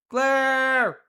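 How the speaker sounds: loud, high drawn-out holler; calling out. Real, not acted. Streamer hailing friend in voice chat, calling out. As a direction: holler the name as a big greeting.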